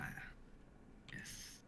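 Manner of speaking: a breathy whispered yes; just whisper the yes; a soft whispered aside. Real, not acted. Hushed agreement.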